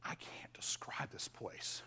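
barely breathed, in awe; awed whisper of someone lost for words; hushed whisper. Retold or imitated. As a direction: whisper it as if words fail you. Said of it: "John is saying".

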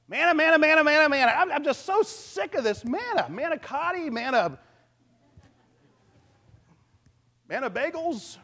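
high, loud mock whine; hammed-up sick-of-it griping; whiny complaining. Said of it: "You can hear them grumbling".

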